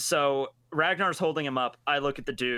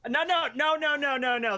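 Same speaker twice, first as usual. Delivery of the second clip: urgent; 10 semitones higher than usual. Acted. Stopping the mailman.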